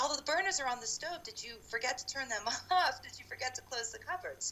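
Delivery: high-pitched, incredulous questioning; retold accusing questions. Questions friend about burners left on.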